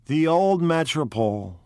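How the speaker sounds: lost in dark memories; gloomy brooding. Acted. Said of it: "brooded Mister Wolfsheim gloomily".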